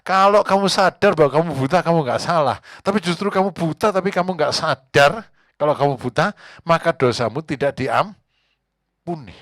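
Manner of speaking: Jesus rebukes the Pharisees, loud and stern; Jesus rebuking. Acted. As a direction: warn the Pharisees firmly. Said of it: "Tuhan bilang gini".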